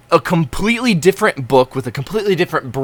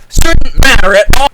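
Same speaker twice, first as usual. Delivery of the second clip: sudden loud, high outburst; loud comic outrage; exasperated shout; 9 semitones higher, 9 dB louder than usual. Retold or imitated. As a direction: burst into yelling mid-rant. Ranting about returning digital books.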